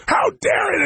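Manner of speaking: high, breathless outraged gasp of a question; mock shocked, pitch shooting up; outraged disbelief. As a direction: squeal it like she can't believe her ears. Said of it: "her face exploded".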